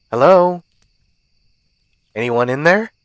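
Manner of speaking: lightly voiced stranger knocking at a door; calling at the door. A narrator's reading. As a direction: call out to see who's home. Figure calling through the door.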